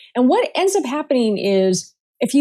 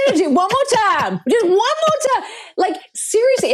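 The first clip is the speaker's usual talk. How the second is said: higher mock-menacing dare; Samuel Jackson dare; 9 semitones higher than usual. Guest echoes the dare against jargon, higher mock-menacing. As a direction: threaten playfully, tough-guy style.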